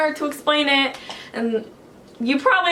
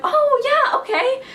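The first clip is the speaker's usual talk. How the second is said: high-pitched put-on voice; imitated phone staffer; 13 semitones higher, slower than usual. Show staffer answering a fan's call, high imitated voice. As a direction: lift it way up, imitating the person answering.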